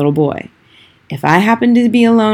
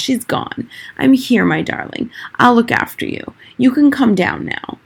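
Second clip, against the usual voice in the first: reassuring; 6 semitones higher than usual. A narrator's reading. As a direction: gently coax the boy down. Grandmother reassures frightened boy.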